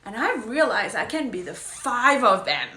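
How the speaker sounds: loud, triumphant revelation; proud friend. Retold or imitated. Friend proudly claiming five archetypes, loud. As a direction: announce it like a big breakthrough. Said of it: "she was like".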